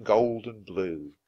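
plain reading voice

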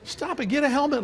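a hint of a scolding counselor; mock stern snap. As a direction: cut them off firmly, half joking. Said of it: "You don't need to say".

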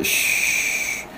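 a long unvoiced "嘘" hiss, slow, like hushing someone; shushing sound. Shown as a demonstration. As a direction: hush them with a slow, breathy "嘘". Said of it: "就有点像你叫人家不要讲话".

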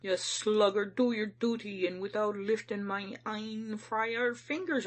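stern, urgent self-reproach. A narrator's reading. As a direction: order yourself sternly to act. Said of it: "I said sternly".

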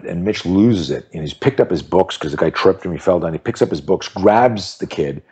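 ordinary talk, nothing marked

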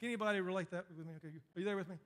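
ordinary delivery

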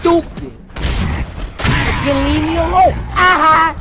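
high, loud taunting shout. Real, not acted. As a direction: yell it at the enemy. Yelling at enemy in game.

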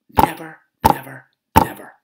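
high, loud defiant chant; defiant yell. Acted. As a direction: shout it with stubborn defiance. Defiant tantrum.

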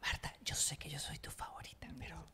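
secret whisper to Marta; conspiratorial whisper to a fan. Acted. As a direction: whisper it like a confidence. Teasing aside to listener's mother.

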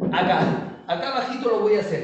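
ordinary talk, nothing marked

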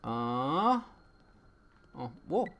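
ordinary talk, nothing marked

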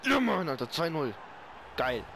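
loud gamer cheer; excited cheer. Real, not acted. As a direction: shout out the score gleefully. Player cheering own goal.

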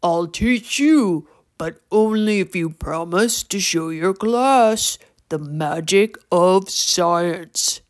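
slow gravelly troll making a bargain; measured troll drawl; Torque. A narrator's reading. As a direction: lay down the condition in a rough creature voice. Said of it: "deep and gravelly voice".

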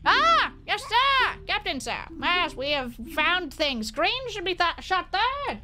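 high, swooping servile voice; hammed-up obedient minion; deferential underling. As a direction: greet a superior in an eager, high put-on voice. Voices game characters reporting to sir.

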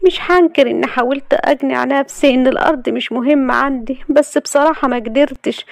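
high voice, mock-sorry; fake regret. A narrator's reading. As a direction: feign sorrow you don't feel. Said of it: "وقالت بصوت اسف مصطنع".

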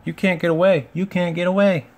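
high, loud sing-song taunt; gleeful teasing. A narrator's reading. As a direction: jeer playfully at someone trapped. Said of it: "laughed the coaches".

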